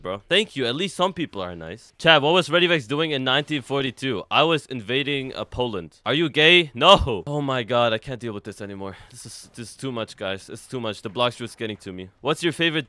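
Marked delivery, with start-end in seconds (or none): none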